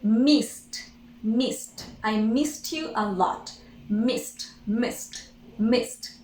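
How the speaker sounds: "Missed" as one syllable ending in T; final T sound. Shown as a demonstration. Teacher drilling the simple past of miss, final T sound. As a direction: drop the E in "missed" and release a T. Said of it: "we simply say T".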